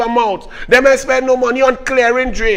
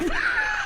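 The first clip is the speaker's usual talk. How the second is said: high-pitched burst of laughter; laughing; 12 dB quieter than usual. Real, not acted. Host cracks up at the minister's boast, laughing. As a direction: break up laughing.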